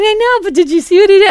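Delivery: high, loud excuse bubbling with laughter; giggly protest. Retold or imitated. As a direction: plead through giggles, mimicking yourself. Her laughing protest to coach.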